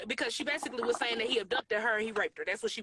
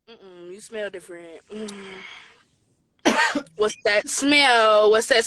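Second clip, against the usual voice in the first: suspicious sniffing; 3 semitones lower, 11 dB louder, much slower than usual. Retold or imitated. Suspicious sniffing, drawn-out questions.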